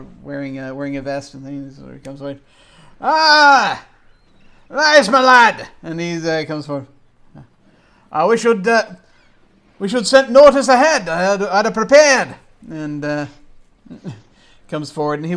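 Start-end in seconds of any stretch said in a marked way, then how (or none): 3.0-5.7 booming, much higher and louder delighted greeting; an old man thrilled his lad has come; hearty old gentleman. Acted. 8.1-12.4 booming, much higher and louder delighted greeting; an old man thrilled his lad has come; hearty old gentleman. Acted.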